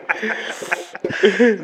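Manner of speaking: laughing through the words; cracking up mid-sentence. Real, not acted. Joking about repenting, laughing.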